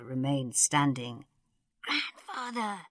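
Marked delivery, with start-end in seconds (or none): 1.8-2.9 high, soft, urgent hiss. A narrator's reading. Susan urging grandfather to kneel, hissed. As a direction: hiss it slowly under your breath. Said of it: "Susan hissed".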